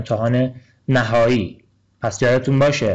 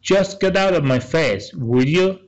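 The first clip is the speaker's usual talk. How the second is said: half the usual pace, pitched higher, each word spaced out; slow, raised dialogue read; slow, clear dialogue line; 5 semitones higher, much slower than usual. Shown as a demonstration. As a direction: read it slowly for learners to catch. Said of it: "پگی میگه".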